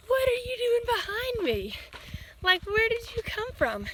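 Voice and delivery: high, slow, disbelieving; incredulous. Retold or imitated. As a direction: stretch it out in wide-eyed disbelief. Friend appears after fifty miles.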